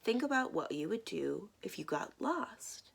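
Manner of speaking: usual speaking voice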